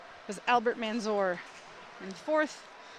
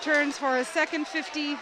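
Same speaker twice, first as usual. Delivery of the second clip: excited swim commentary, getting louder towards the finish; live play-by-play; 3 semitones higher, 8 dB louder than usual. Real, not acted. Announcer calling a close breaststroke race, excited and building to a shout. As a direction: build from brisk race call to a loud whooping peak.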